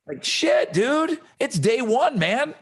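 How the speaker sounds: high-pitched, incredulous mock protest; dismayed protest. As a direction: object like a stunned student. Acts out dismayed student reaction.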